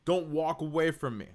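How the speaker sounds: high-pitched, loud mock New York accent; bad New York accent. Podcaster delivering a joke punchline, bad New York accent. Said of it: "That's a bad New York accent".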